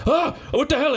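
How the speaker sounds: high shocked exclamation. Retold or imitated. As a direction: yelp it in disbelief. Landlord sees the leak photo.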